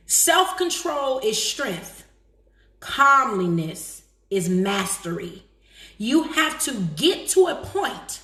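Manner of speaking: slow, measured reading with drawn-out key words; solemn quote reading. Real, not acted. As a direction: deliver each maxim slowly and deliberately. Reads out a quote.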